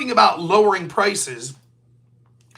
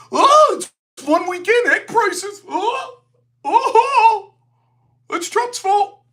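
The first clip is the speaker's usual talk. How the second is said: much higher, louder whiny mocking voice; facetious mockery; 12 semitones higher, 3 dB louder, slower than usual. Commentator mocking Democrats in a high facetious whine. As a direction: whine it in a high sarcastic sing-song. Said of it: "be facetious".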